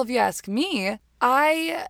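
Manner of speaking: ordinary talk, nothing marked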